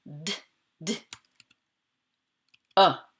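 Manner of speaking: "d, d, a" as separate short sounds; isolated sounds. Shown as a demonstration. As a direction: tap out the bare d sound twice, then an open "a". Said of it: "j'entends d".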